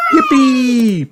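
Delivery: high, loud, drawn-out cheer; excited shout. A narrator's reading. Child told to jump in puddles, excited shout. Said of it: "Peppa shouts".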